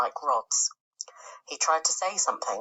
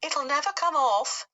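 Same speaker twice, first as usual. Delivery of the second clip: bright; 12 semitones higher than usual. Acted. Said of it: "the earthworm said brightly".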